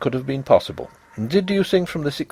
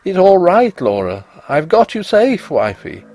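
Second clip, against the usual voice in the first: higher, louder frantic comfort; desperate cry; 6 semitones higher, 4 dB louder than usual. A narrator's reading. Husband clutching his dead wife, higher louder desperate reassurance. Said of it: "caught her in my arms and cried".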